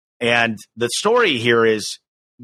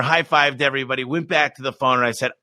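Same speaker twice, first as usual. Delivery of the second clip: ordinary delivery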